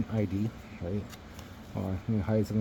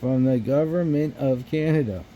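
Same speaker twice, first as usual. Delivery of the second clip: "From the government of Canada" higher and louder, every word hit; emphatic proof; 4 semitones higher, 7 dB louder than usual. Retold or imitated. Storyteller showing his ID as proof, "From the government of Canada" loud and emphatic. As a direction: announce "From the government of Canada" emphatically, like a trump card.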